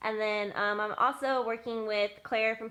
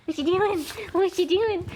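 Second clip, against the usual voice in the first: high loud dog talk; squeaky praising a dog; 8 semitones higher, 7 dB louder than usual. Real, not acted. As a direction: ask the pup in a squeal. Talking to dog.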